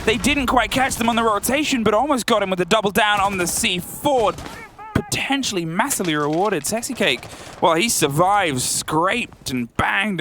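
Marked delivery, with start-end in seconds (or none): 0.1-8.6 excited play-by-play, higher pitch; esports caster. Acted. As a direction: call the action with rising hype.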